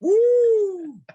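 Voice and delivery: high, drawn-out wrestler's "woo"; triumphant showman's whoop; Ric Flair. Acted. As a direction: let out a long, high whoop. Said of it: "Ric Flair".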